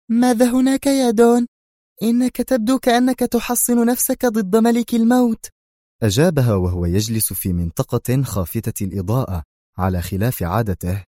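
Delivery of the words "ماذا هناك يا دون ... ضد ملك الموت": high woman's voice, louder and edgy; nervous Sonia. A narrator's reading. Sonia confronts the Don about his guards, nervous and high. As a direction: snap at the Don in a tense woman's voice. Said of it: "هتفت في عصبية".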